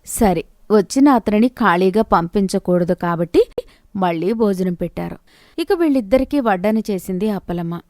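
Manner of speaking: plain reading voice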